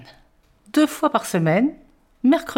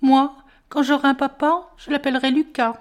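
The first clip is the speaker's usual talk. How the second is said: put-on childlike voice; 6 semitones higher than usual. Child's wish voiced in story.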